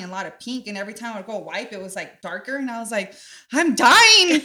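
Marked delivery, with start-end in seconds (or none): 3.5-4.4 high, loud hammed-up cry of doom; imitating a terrified girl, pitched way up; mock panic. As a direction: play your younger self sure she's dying. Retelling first period panic.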